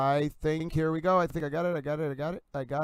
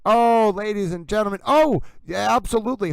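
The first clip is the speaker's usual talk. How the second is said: much higher, loud, ecstatic gush; loud, high overjoyed squeal of pride; giddy exclamation; 6 semitones higher, 8 dB louder than usual. Retold or imitated. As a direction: burst out at a stranger in pure glee. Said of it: "I would have been like".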